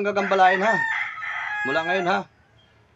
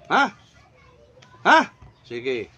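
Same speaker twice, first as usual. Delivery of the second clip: usual speaking voice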